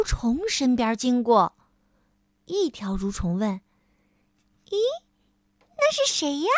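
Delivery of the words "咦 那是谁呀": 尖细的小虫子声音; 用细高的虫子声好奇地问; 好奇的小蠕虫. Acted. Worm voice, high. (一条蠕虫好奇地问同伴)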